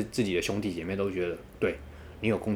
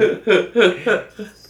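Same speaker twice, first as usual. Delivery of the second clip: laughing; 11 semitones higher, 7 dB louder than usual. Real, not acted. Laughing after exclaiming.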